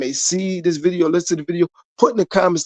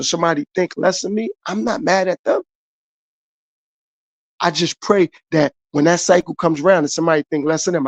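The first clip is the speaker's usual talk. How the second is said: normal talking voice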